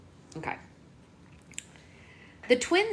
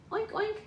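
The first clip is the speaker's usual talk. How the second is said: very high squeaky oinks; mother showing her baby what a piggy says; 3 dB quieter than usual. Shown as a demonstration. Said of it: "What does the piggy say".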